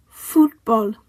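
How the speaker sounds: Fútbol with a loud FÚT; stress on accented syllable. Shown as a demonstration. Teacher modelling written accents, Fútbol stressed on first syllable. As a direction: say Fútbol in Spanish, FÚT-bol. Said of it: "stress the syllable that the accent is on".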